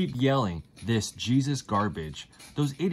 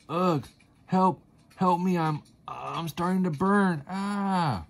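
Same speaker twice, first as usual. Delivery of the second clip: high, strained cries for help; dying cry for help; 9 semitones higher, slower than usual. Acted. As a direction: gasp it out like a dying man.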